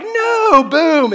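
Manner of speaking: high, loud, panicked "No!"; panicked shout. Retold or imitated. Husband refusing the bellhop's help, panicked shout. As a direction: slam the door on the offer.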